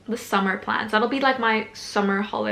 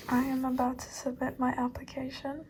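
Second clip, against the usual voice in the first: whisper; 3 semitones higher, 7 dB quieter than usual. Real, not acted. Nervously about to submit application.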